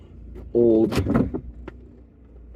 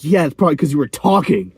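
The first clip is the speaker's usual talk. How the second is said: louder, higher mock scolding; raised-voice, exasperated comeback; imitated teacher retort; 5 semitones higher, 6 dB louder than usual. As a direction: imitate a teacher accusing a student of talking.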